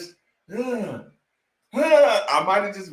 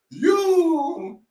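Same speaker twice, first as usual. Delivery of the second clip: mock dunk yell; 7 semitones higher than usual. Reenacting his dunk yell.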